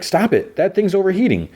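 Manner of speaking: high-pitched Spider-Man warning. Retold or imitated. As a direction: pitch it way up as the panicked hero. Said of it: "And Spider-Man's like".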